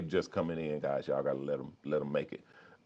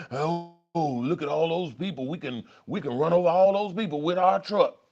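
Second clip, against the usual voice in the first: crazy inner voice; 9 semitones higher than usual. Pretend. Voices in your head joke.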